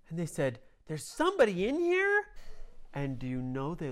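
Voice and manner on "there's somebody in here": very high, louder exclamation; high surprised. A narrator's reading. Heroes finding someone inside the belly, high surprise. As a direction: gasp it out in shock.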